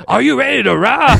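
high, loud mock arena call; playful mock rock star shout; rock hype man. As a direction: ham up a rock frontman working the crowd. Mocking rock concert hype man.

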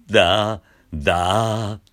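short fry da; creaky da onsets. Shown as a demonstration. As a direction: clip da from the creak. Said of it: "しゃくるのが大事".

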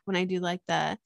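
usual speaking voice

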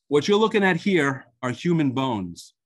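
normal talking voice

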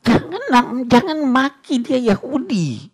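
high-pitched retold scolding; angry rebuke. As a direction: act out a stern prohibition, pitch leaping up. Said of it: "Nabi marah".

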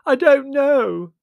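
high, loud, drawn-out wail; girl's loud, despairing wail. Acted. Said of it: "wailed Margot".

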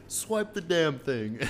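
mock drunk demand. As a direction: drag it out like a tipsy customer. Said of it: "because when I was drunk".

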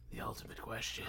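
soft, suspenseful stage whisper; dramatic whisper. Pretend. Host repeats the segment title, dramatic whisper.

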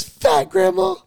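loud retold protest, shooting up high at the end; insistent yell. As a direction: insist loudly, imitating an outraged friend. Friend argues back to grandma, acted.

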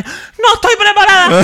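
high, loud, laughing panic; mock panic. Fan squealing in mock panic as her turn comes. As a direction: squeal that you're not ready.

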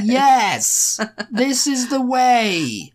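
slow, loud, high over-enunciation; talking to the deaf. Pretend. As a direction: spell it out loudly as if to a deaf person. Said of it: "like, they're deaf".

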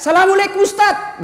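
imitating a high hailing call; called-out greeting. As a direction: call out to the ustaz, pitched high. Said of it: "ada yang teriak". (menirukan orang berteriak memberi salam)

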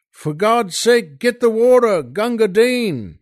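high, loud urgent cry for water; desperate soldier's shout. Acted. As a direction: shout for help over the wounded man. Desperate call for wounded man.

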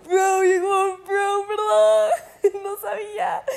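high, loud, laughing all through; real laughter retelling the awkward apology. Real, not acted. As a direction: crack up while retelling. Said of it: "Fue muy chistoso".

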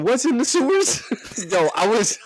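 laughing. Retold or imitated. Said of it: "I said".

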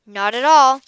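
loud, frank admission; admitting. A narrator's reading. Boy confessing he cannot shear, admitting. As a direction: own up cheerfully and loudly. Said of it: "admitted Josh".

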